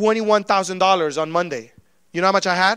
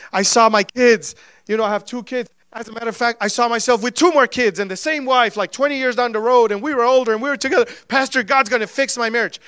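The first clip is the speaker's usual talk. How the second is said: higher, louder, building excitement; overcome by a vision; 3 semitones higher, 4 dB louder than usual. Retold or imitated. Husband telling pastor his vision, increasingly excited. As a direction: pour it out with rising urgency and hope. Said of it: "And they start crying".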